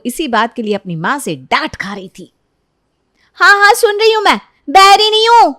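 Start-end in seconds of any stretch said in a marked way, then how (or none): 3.4-5.5 put-on much higher, louder girl's voice; irritated teen daughter. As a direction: shout back at mom, fed up. Sunita snapping at her mother. (माँ पर झल्लाती हुई बेटी, बहुत ऊँची और तेज़ आवाज़)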